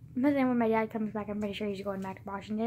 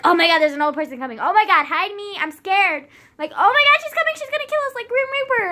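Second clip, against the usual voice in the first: high, loud, fast panicked squeal; panicked friend; 9 semitones higher, 11 dB louder than usual. Retold or imitated. Friend panicking about an approaching old woman, high frightened squeal. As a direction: shriek in mock terror at the old lady. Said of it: "she was just like".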